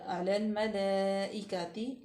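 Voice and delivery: अलल मलाइकती slow, laam joined; laam merged. Shown as a demonstration. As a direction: glide the laam into the next. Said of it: "लाम में मिलाएंगे इसको".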